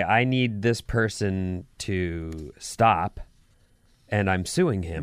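slow, low, deliberate statement; firm demand. Acted. Client telling a lawyer to stop a harasser, firm. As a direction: lay down the demand slowly and low.

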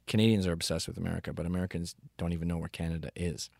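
normal talking voice

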